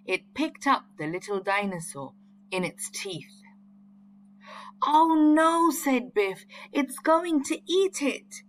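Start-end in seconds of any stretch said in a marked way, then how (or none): 4.8-8.4 high, loud gasp of alarm; storybook alarm, higher and louder. A narrator's reading. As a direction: cry out in dismay for the baby. Biff sees the dinosaur seize the baby.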